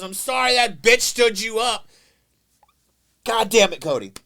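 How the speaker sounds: loud, slow, high brash yell; loud mock yell. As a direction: bellow it as you barge through the door. Brother barges in yelling.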